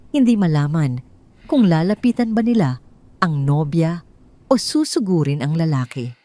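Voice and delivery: ordinary delivery, nothing marked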